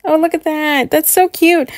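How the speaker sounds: ordinary delivery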